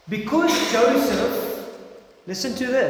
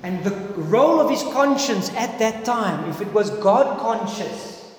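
ordinary talk

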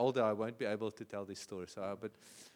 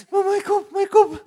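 put-on much higher, loud, whining little boy; wailing child; 18 dB louder than usual. Small son wailing to his mother about his head. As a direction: whine it like a hurt kid.

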